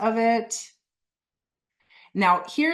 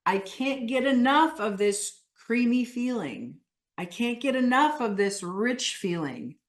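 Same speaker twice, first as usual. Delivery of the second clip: animated, higher-pitched emphatic declarations; 3 semitones higher than usual. Shown as a demonstration. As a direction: say it with insistent, animated relish. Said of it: "when you say it emphatically".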